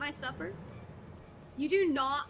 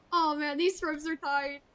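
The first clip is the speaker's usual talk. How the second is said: ordinary talk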